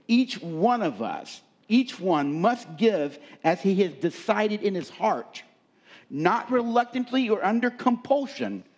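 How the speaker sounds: high, loud pulpit reading of scripture; fervent scripture preaching. Acted. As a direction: proclaim each verse with rising fervor. Preaching scripture at full force.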